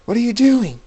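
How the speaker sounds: high, loud cry of disbelief; shocked shout. A narrator's reading. Jacob shouting at the girl who pushed him, loud and high. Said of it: "Jacob says".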